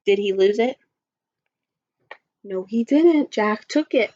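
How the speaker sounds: ordinary delivery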